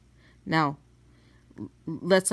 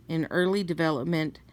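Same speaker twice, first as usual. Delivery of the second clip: usual speaking voice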